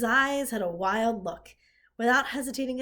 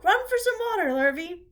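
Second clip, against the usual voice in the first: higher, louder flustered calls; flustered hollering; 10 semitones higher, 5 dB louder than usual. A narrator's reading. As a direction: keep hollering orders in a fluster. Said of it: "hollered Zuckerman".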